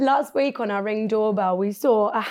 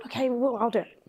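whisper; 5 dB quieter than usual. Real, not acted. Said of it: "whispering reply".

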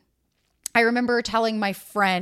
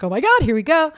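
higher and louder, thrilled squeal of anticipation; giddy excitement; 6 semitones higher, 6 dB louder than usual. Retold or imitated. Giddy as her crush picks her up. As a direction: gush it like the date is finally happening.

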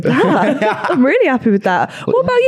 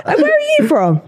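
retold surprise. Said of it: "I was like".

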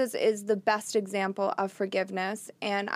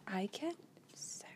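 whisper; 17 dB quieter than usual. Pretend. Jokey confession then reply.

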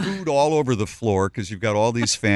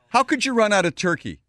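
indignant Bob; 6 semitones higher than usual. Retold or imitated. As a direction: make the complaint sound outraged. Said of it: "I become Bob".

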